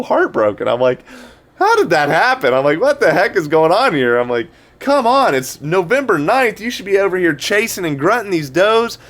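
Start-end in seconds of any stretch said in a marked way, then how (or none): 1.6-4.2 mock disbelief. Retold disbelief as does leave. 4.8-9.0 retold frustration, voice pitched up; exasperated plea. Retold pleading with the buck.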